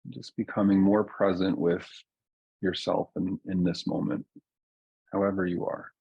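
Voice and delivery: guided meditation. Real, not acted. Teacher settling a group into their bodies, calm guided meditation. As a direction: ease listeners down gently, leaving long silences. Leads a slowing-down grounding meditation.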